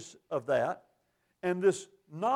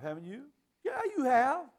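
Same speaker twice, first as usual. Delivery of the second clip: ordinary talk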